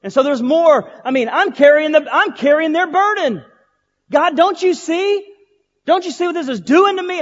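loud, high plea to God; anguished protest. Retold or imitated. Preacher relives arguing with God over his burden, anguished protest. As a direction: cry out in frustration, voice way up.

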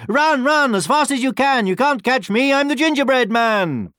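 high, loud sing-out taunt; gleeful high-pitched storybook boast; taunting gingerbread man. A narrator's reading. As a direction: taunt pursuers in a high little voice. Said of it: "just laughed and said".

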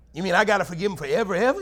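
quick, incredulous complaint; hammed-up disbelief; mock protest. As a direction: act out the reluctant forgiver.

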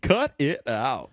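slow, drawn "Cut it out" in a put-on voice; Uncle Joey catchphrase. Trading Full House catchphrases, slow put-on catchphrase voice. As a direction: ham up the Uncle Joey line.

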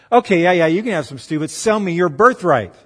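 mildly hammed-up pushy younger brother; eager bargaining. Younger brother offers Esau stew for his birthright, pushy bargaining.

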